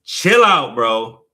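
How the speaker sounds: loud high exasperated shout; retold yell. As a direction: yell at the pushy birthday guy to back off. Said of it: "I was like".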